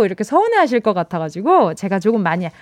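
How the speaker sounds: usual speaking voice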